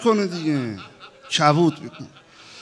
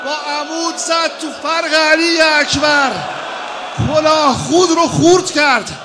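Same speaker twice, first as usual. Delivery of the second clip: loud, high lament preached at full force; wailing mourning sermon; 9 semitones higher, 7 dB louder than usual. Acted. Preacher wailing Hajar's grief to the mourners, loud and high. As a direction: cry the story out to the mourners.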